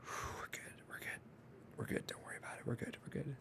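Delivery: a strained, breathless whisper fighting back tears; choked whisper. Retold or imitated. Man reassuring himself through tears at Coco, whispering. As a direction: whisper it to yourself so nobody in the theater hears. Choking up in the theater.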